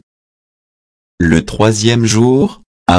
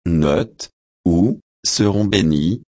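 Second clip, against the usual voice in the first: quiet aside; 5 dB quieter than usual. Real, not acted. Reader's aside on translation note.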